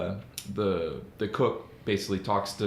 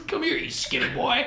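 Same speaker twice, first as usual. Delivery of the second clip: brutish bully. Retold or imitated. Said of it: "He comes".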